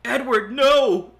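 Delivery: slow, high, loud despairing cry; anguished cry. Acted. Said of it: "Alex cried".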